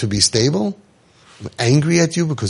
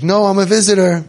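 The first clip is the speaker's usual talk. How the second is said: high, loud insistent denial; indignant protest; 8 semitones higher, 4 dB louder than usual. Retold or imitated. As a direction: protest you're only visiting, pitched up. Protesting in psych ward.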